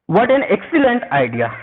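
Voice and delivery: very slow, clear example sentence; "What an excellent idea" drawn out, every word clear; slow example read. Shown as a demonstration. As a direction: read it out slowly for the class. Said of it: "देखिए यहां पर".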